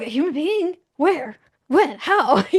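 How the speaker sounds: loud, startled string of questions; acting out baffled disbelief; shocked. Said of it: "I was shocked".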